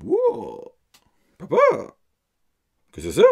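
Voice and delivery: son's wow. Retold or imitated. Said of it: "t'es comme".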